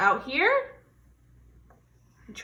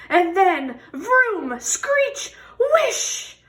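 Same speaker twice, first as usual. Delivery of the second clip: much higher, louder vroom and screech; noisy sound effects; 8 semitones higher, 8 dB louder, much slower than usual. Acted. Teacher making the bus noises for the class, loud and high. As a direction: burst out the traffic noises.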